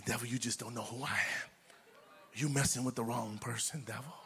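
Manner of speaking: hushed, near-whispered rebuke; whisper to the devil. Shown as a demonstration. Preacher whispering a rebuke at the devil. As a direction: quietly tell the devil off under your breath. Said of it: "We could whisper to the devil".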